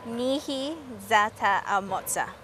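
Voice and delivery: Nihi Zata Amotsa said slowly with stress on ZAta and moTsa; careful learner repeat. Shown as a demonstration. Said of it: "Okay, let me try".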